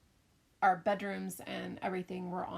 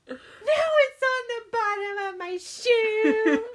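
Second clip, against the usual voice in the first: put-on high, loud, slow half-crying child; whining little girl; 13 semitones higher, 12 dB louder, slower than usual. Mom imitating her daughter's wailing complaint, laughing. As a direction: whimper it like a grossed-out kid.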